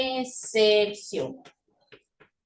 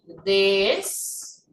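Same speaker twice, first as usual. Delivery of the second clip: Des-ye-lo in three long, separate syllables; very slow syllable demo of Des-ye-lo; 3 semitones lower, much slower than usual. Shown as a demonstration. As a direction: stretch Des... ye... lo with wide gaps. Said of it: "yo pronuncio así".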